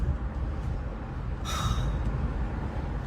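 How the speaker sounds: tired sigh. Real, not acted. Worn out after yelling.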